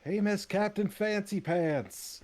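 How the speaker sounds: high, loud mocking call from above; shouting from crow's nest. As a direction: yell it down from up high. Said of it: "I am going to yell down from the crow's nest".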